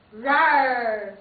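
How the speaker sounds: loud beastly roar; huge fiery dragon roar. Pretend. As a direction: roar like a giant winged monster. Roaring a fierce beast guess.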